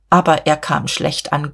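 plain reading voice

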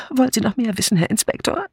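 high, fast, dithering spinster voice; flustered Miss Murgatroyd. A narrator's reading. Miss Murgatroyd fumbling her alibi for the inspector, flustered.

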